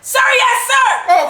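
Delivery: high, very loud recruit's reply; mock military shout. Wife answers husband's challenge, mock military shout.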